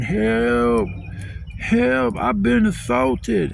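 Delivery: high, drawn-out cry for help; mock screaming. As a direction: imitate hollering out a window for help. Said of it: "hanging out his window screaming".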